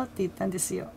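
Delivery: ordinary delivery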